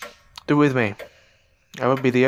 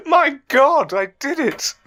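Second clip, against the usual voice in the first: amazed delight; 10 semitones higher, 3 dB louder than usual. Acted. Amazed at own success.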